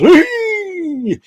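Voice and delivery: squealed high whee; high silly whee. Pretend. Vlogger squealing during shout-outs in a silly voice. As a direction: squeal it playfully. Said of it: "in this voice".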